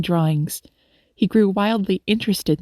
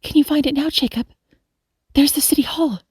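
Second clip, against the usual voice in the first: lightly voiced, higher young lady; higher voice for a kindly young lady; young woman coaxing; 7 semitones higher than usual. A narrator's reading. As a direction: gently prompt a small child, pitched up. Woman coaxes lost child.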